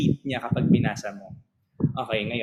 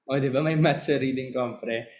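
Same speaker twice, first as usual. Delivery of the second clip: ordinary talk, nothing marked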